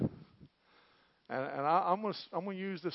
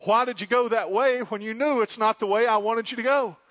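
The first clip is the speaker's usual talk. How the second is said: loud scolding rebuke, rising higher; God scolding; 7 semitones higher, 10 dB louder than usual. Retold or imitated. God rebukes the preacher for his own mistake, scolding. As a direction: scold a foolish child. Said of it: "he has to remind me".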